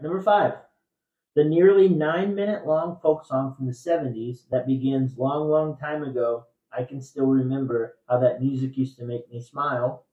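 loud, measured trivia question read; quiz host reading. Acted. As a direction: read the clue out to the room.

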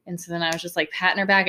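normal talking voice

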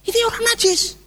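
high, squeaky disbelief; shocked crowd. Acted. Onlookers aghast at an unclean man, high shocked voice. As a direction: exclaim in a high thin voice. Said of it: "semua mata terbelalak".

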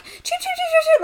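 high, loud frantic chirping of a trapped bird; distressed bird chirp. Retold or imitated. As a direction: squeak it out like a panicked little bird. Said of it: "all I could hear was this bird going".

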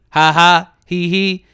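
spelled-out mock giggle; sarcastic fake laugh. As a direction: laugh derisively at the joke. Mocking people who found it funny.

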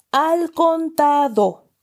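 al contado stretched slow and high; emphasised. A narrator's reading. Uncle insisting the debt be paid al contado, slowly stressed. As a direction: lean hard on al contado. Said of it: "recalcó".

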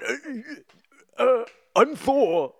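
high, slow bewildered rambling; confused Thor. Retold or imitated. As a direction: stammer like a lost hero. Imitating Thor improvising.

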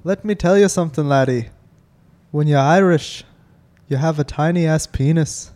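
mock Irish brogue drifting Scottish at the end; Irish accent. Bad Irish accent slipping into Scottish for his podcast mates. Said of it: "That's a little Scottish at the end".

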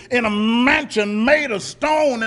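ordinary delivery

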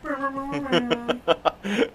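high, loud brr brr blasts, laughing by the end; imitated air horn. Cohost fills a missing sound effect with a loud mouth air horn. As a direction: blare it like a mock air horn. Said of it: "We need an air horn sound here".

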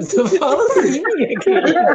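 high, loud laughing protest; a speaker telling the linguist this is just how she talks, in a loud laughing protest. Retold or imitated. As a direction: burst out defending your own way of talking, laughing. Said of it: "Eu falei".

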